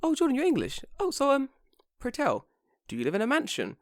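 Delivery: high, airy imitation of a curious coworker; polite imitation. Coworker curious about an English person's home, high polite imitation. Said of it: "She probably said it as like".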